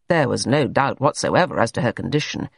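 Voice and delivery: plain reading voice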